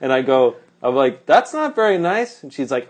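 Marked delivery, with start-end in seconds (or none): 1.3-2.4 high, mildly wounded objection; retold timid protest. Narrator objecting to the angry woman's insults, timidly. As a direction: pitch it up in polite protest.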